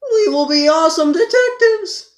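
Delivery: very high, loud cartoon cheer; cartoon hero shout. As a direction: shout it like an eager cartoon sidekick. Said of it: "they raise their right hands and go like".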